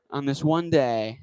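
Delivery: usual speaking voice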